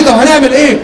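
loud, high panicked question; panicked shout. Retold or imitated. Quoted panic.

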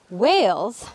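usual speaking voice

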